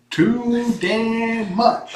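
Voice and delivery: TOO DAMN MUCH high, loud and drawn out; playful emphasis. Real, not acted. Joking with partner about cat numbers, TOO DAMN MUCH slow and loud.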